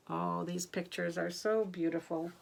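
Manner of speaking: usual speaking voice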